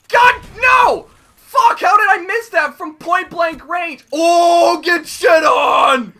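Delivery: loud high frantic yelling; wild shouting. Real, not acted. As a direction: hype yelling mid-fight. Wild yelling during gameplay.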